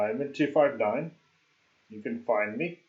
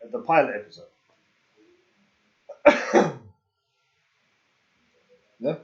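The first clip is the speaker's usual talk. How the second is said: normal talking voice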